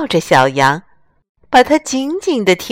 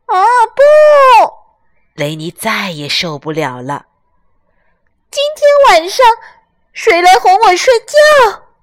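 much higher, louder desperate lamb; 12 semitones higher, 6 dB louder than usual. Acted.

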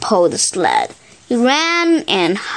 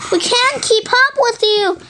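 panting; 12 semitones higher than usual. Acted. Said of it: "panted the husky dogs".

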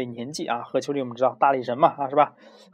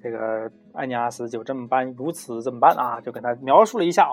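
ordinary delivery, nothing marked

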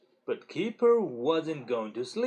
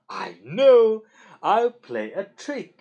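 sudden loud, gleeful "I know"; gleeful plotting; 7 semitones higher, 11 dB louder, slower than usual. A narrator's reading. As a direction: burst out with a naughty idea. Child gleefully plots trick.

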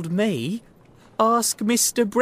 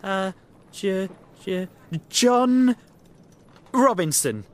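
halting, stuttered start, then louder blurt; stammering hesitation; 6 semitones higher, 5 dB louder than usual. Acted. Little man giving Holmes a false name, stammering. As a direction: stumble on the first sound, then blurt it.

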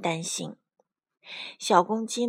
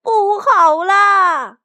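loud, high, drawn-out alarm cry; panicked shout; 10 semitones higher, 12 dB louder, much slower than usual. A narrator's reading. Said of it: "惊慌地大喊". (惊慌地大喊)

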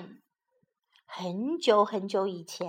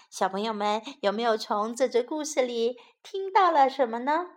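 plain reading voice

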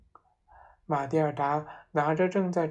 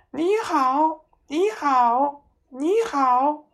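high, squawking parrot greeting; much higher parrot voice; 10 semitones higher, 8 dB louder, much slower than usual. A narrator's reading. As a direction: chirp it like a bird. Parrot heard from dining room.

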